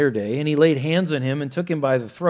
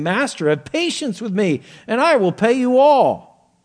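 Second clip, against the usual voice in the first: loud pleading; 9 semitones higher, 9 dB louder than usual. Acted. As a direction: cry out the servant's plea to the master. Reading the servant's plea.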